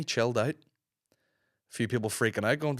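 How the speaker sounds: ordinary delivery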